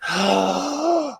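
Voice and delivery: big mock gasp. Host pretends to run out of breath while greeting viewers, big mock gasp. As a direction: gasp as if winded from greeting everyone. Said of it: "let me take a breath".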